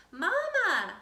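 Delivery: high, loud call to mother. Acted. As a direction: call Mama with excitement. Child calls out to mother.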